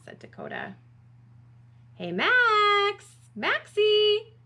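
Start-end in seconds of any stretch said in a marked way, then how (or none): 1.9-4.3 high, loud singsong call; drawn-out searching call. A narrator's reading. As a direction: holler through the house for a friend. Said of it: "called Brody".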